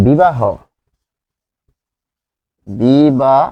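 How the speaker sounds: বিবাহ said slowly as "bibah", final h held; বিবাহ drawn out, teacher modeling the sound; pronouncing the word. Shown as a demonstration. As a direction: spell out বিবাহ clearly for students. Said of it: "অ উচ্চারণ রয়েছে".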